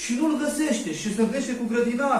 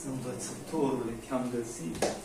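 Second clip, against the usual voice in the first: hushed joy; 6 semitones lower, 7 dB quieter than usual. Acted. Mary finds Jesus, hushed.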